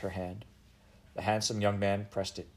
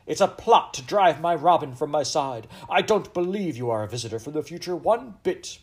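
loud, higher-pitched indignant Queen; angry accusation; 7 semitones higher, 7 dB louder than usual. Acted. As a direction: accuse with royal outrage. Furious Queen accuses Eliza.

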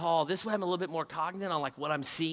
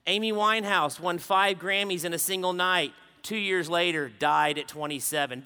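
usual speaking voice

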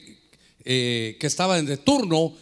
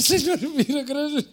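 high, sobbing, hammed-up thank-you; mock tearful thanks; 9 semitones higher than usual. Parent thanking God at graduation, mock weepy. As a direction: overdo the weepy gratitude with a laugh. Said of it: "cómo va a llorar usted".

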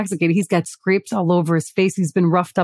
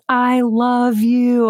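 slow, drawn-out high "I love you"; departing drunk kid calls from the van, slow and high; mock drunk yell; 5 semitones higher, much slower than usual. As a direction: stretch it out like a happy drunk calling back. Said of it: "he yells".

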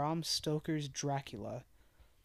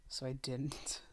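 whispered aside; 2 semitones lower, 8 dB quieter, slower than usual. Real, not acted.

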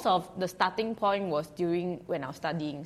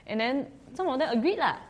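ordinary talk, nothing marked

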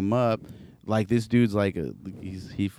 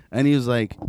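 usual speaking voice

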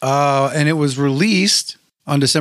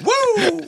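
wrestler woo. Acted. Wrestler style cheer.

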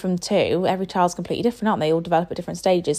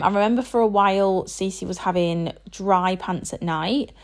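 normal talking voice

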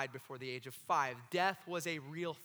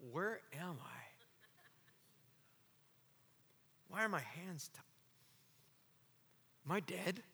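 dazed and confused; 6 dB quieter than usual. Acted. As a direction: wake up lost in the dark. Lazarus waking confused in tomb.

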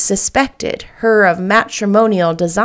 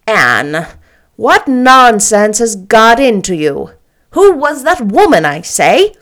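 stern Marilla; 6 dB louder than usual. Acted.

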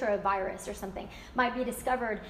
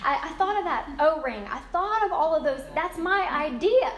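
indignant friend claiming credit; 5 semitones higher, 6 dB louder than usual. Retold or imitated.

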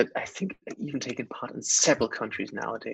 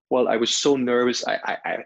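ordinary talk, nothing marked

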